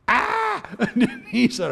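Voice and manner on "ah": short, loud, high yelp of horror; mock nightmare scream. Preacher acts out uncle waking from judgment dream, mock scream. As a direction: cry out as if jolted from a nightmare.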